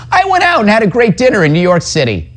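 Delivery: high-pitched mocking imitation of an anchor; mocking, high-voiced quote; mock Lemon quote. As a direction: pitch it way up, parodying his boast. Mocks Lemon before his clip.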